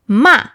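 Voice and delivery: Ma falling sharply from high; "Ma" short with a steep fall; fourth tone on Ma. Shown as a demonstration. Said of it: "that means to scold someone".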